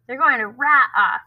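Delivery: taunting call with a small lift; mild mock yell. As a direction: jeer at him from the bus. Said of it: "Norton yelled".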